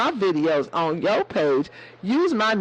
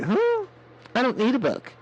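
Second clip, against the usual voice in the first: put-on kid's dismissive huh; 6 semitones higher than usual. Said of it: "He gonna be like".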